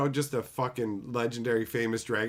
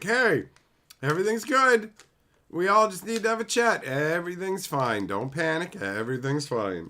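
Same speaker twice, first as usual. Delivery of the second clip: mock an executive insisting all is well; falsely reassuring CEO; 4 semitones higher than usual.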